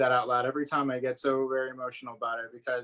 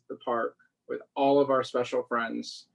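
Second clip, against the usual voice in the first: usual speaking voice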